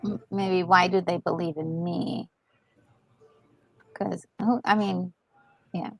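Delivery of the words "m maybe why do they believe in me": normal talking voice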